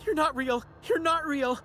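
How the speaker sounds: high, terrified yelling; panicked shout. Acted. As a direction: scream it in panic. Panicked NPC yells at phantom.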